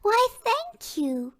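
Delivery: high, slow, hammed-up gracious thanks; mock gracious. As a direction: play along like a grand lady. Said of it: "I'll play along".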